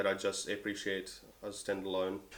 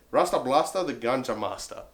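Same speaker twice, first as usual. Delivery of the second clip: normal talking voice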